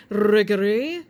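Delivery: riggery with rolled posh British R; trilled R's. Shown as a demonstration. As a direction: roll the R in riggery like a phony Brit. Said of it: "You have to say it with the trill R's".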